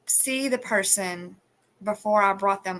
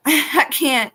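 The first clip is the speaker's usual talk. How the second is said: breaking into laughter; can't keep a straight face; laughing; 5 semitones higher, 8 dB louder than usual. Real, not acted. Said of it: "I was really trying to keep a straight face".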